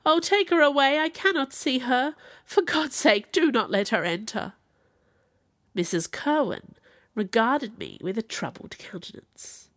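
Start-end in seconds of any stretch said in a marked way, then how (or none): 0.0-4.5 acted outburst of agony, pitched well up; agonised cry. A narrator's reading. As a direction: cry out in anguish, begging. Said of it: "cried out in agony".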